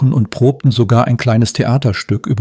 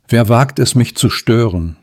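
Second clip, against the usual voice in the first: usual speaking voice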